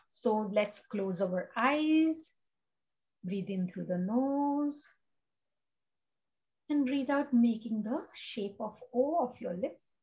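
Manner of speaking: slow, soft breathing guidance; calm meditation teacher guiding the group; guided meditation. Acted. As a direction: ease them into the breath, slowly.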